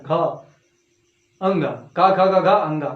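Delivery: low voiced घा and अंगा; voiced resonance demo. Shown as a demonstration. Teacher demonstrating buzzing voiced घा, अंगा. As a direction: let घा and अंगा buzz low in the throat. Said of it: "नाक कान सब लग जा रहा है".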